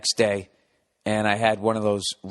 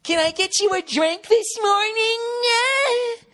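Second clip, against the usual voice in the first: very high, slow sing-song greeting; mock perky flight attendant drawing out each syllable; overly bubbly stewardess; slower than usual. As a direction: stretch every word sweetly. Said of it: "it was just kinda like".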